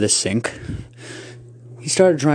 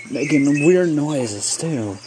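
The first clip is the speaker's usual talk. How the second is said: usual speaking voice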